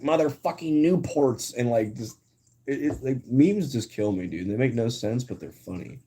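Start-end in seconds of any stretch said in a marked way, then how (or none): 0.0-1.6 high, slow mock awe; Squidward. Streamer delivering the edited meme's punchline, high drawn-out cartoon voice. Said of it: "and he's like".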